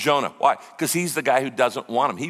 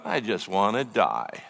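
imitating despair, low and soft; acted hopelessness, voice sunk right down; mock despair; 7 semitones lower, 5 dB quieter than usual. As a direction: drop into a low, quiet, defeated mumble. Acts out Jonah's suicidal despair.

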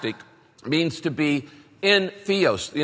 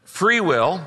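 Free will said higher and louder; stress on Free will; 4 semitones higher, 5 dB louder than usual. Shown as a demonstration. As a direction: lift and punch Free will. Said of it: "circle some words here".

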